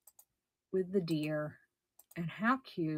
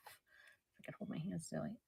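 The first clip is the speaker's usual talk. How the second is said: whispering; 2 semitones lower, 12 dB quieter than usual. Real, not acted. Muttered aside while handling item.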